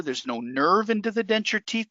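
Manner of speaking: ordinary talk, nothing marked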